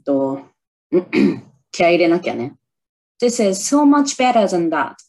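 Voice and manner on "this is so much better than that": movie-style English, a bit higher and louder, slow; movie line imitation. Shown as a demonstration. Vlogger performs the shadowing practice line, spirited and slow. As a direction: deliver it like the heroine, fired up. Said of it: "ちょっとやってみようかな".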